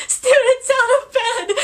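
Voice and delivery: high, loud, slow mock yell; hammed-up caretaker shout; imitating Filch. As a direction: bellow the alarm through the halls. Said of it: "And then Filch comes in".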